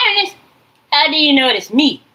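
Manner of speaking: high, loud taunt in the voices' tone; mock taunting shout. Voices taunting her, mimicked as a loud jeer. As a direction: throw the question out like a jeer.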